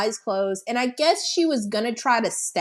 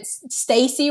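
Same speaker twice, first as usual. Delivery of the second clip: ordinary talk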